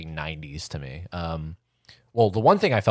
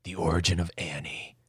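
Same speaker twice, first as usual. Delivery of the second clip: measured, mock-dramatic movie trailer voice; mock trailer announcer; 3 dB quieter than usual. Host teasing co-host with an imagined origin story, mock trailer announcer. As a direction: announce a made-up prequel like a trailer. Pitching a fake prequel trailer.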